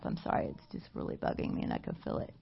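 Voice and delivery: whispering to herself; whispered aside. Real, not acted. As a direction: drop to a whisper. Aside about hair in mouth.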